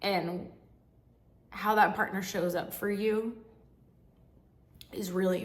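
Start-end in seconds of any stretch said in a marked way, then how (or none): none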